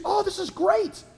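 high-pitched mock delight; piping up. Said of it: "and she pipes up".

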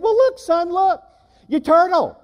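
a father delighted by good news; excited dad. Retold or imitated. Father shows his son the living turtle, high and excited.

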